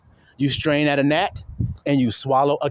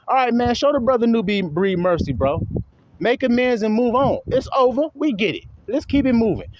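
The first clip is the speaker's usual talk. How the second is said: higher, coaxing follower voice urging him to drop it; mimicking his own followers; 6 semitones higher than usual. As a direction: play a tired follower saying let it go. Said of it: "his own people are saying it to him".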